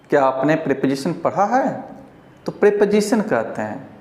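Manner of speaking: ordinary delivery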